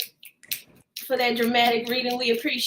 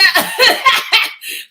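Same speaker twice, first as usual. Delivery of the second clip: bright burst of laughter; loud high laugh; 11 semitones higher, 8 dB louder than usual. Real, not acted. Laughing at her friend's pun, loud high burst. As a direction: crack up loudly.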